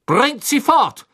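hoch, laut und langsam gedonnert; donnernder Befehl. A narrator's reading. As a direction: befiehl es voller Wut. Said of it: "donnerte Crouch den Dementoren entgegen". (laut und langsam, wütend donnernd)

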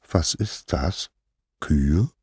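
feigned ignorance. Acted. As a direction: ask mildly as if the word were new.

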